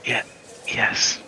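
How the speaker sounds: soft, breath-only defeated yes; defeated admission. Acted. Captive admitting there are prisoners, defeated. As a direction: admit it quietly, head hung. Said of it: "He kinda hangs his head".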